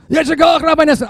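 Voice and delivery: put-on high, loud old accented voice; lightly imitated elderly European professor; Elie Wiesel imitation. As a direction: mimic an elderly professor's warm greeting. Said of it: "I can only do one imitation".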